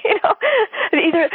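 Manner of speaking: normal talking voice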